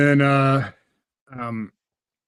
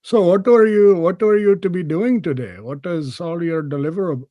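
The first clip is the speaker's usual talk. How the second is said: higher and louder, mock Indian English boss; imitated Indian accent; 5 semitones higher, 5 dB louder than usual. Boss grilling him about deliverables, imitated Indian accent. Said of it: "he could be like".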